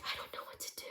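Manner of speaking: croaky whisper, barely voiced; very soft, voiceless acted croak. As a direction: croak it out under the breath. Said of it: "Cassie croaks and she's like".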